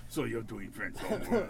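low, soft mock French; imitated French-accented deadpan. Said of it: "I'm doing a French ac".

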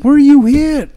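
high, loud urgent shout; retold yelling. Officer shouting at his fallen partner, high and loud. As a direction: bark the question out in panic. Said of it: "uh, he said".

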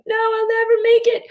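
high, loud frightened cry; panicky inner voice. Acted. Coach voicing the client's fear of letting go, high frightened cry. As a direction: squeal it out like the scared part of you.

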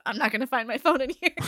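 laughing through the words. Retold or imitated. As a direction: giggle while saying it. Laughing at own predicament.

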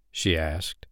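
plain reading voice

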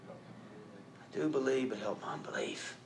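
soft, very slow confession; quiet, halting plea for help. Acted. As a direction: hush it out, humbly. Father's humble confession.

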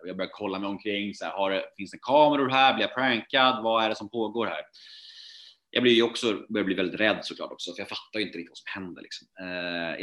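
ordinary talk, nothing marked

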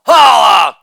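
loud exclaimed tag; big showy holler; shouted catchphrase. Acted. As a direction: belt it out as a punchline button. Shouted catchphrase after each bit.

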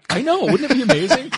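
high, loud and gushing; thrilled squeal of delight; excited. Real, not acted. Excited about a painting.

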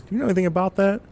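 father quizzing his son about the truck, suspicious; dad asking. Retold or imitated. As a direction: fish for a confession. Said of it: "He goes".

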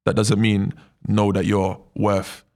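normal talking voice

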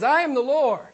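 divine declaration. Acted. Preacher relating God's words from Isaiah, declaring majestically. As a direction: proclaim it with total authority. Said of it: "I love it when my Father talks like this".

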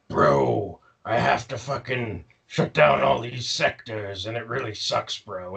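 mopey bro Megatron. Retold or imitated. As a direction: whine like a sad bro venting. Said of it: "Megatron's just like".

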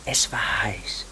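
slow, low whispered opening; ominous whisper. Acted. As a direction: start the tale in a hush. Begins murder story in whisper.

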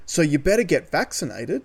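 ordinary talk

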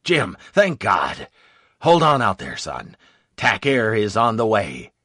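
lightly voiced officer, urging him to hold on; relieved colonel. A narrator's reading. Colonel's relieved reply by radio.

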